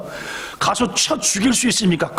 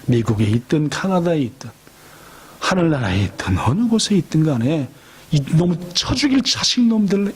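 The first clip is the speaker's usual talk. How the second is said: normal talking voice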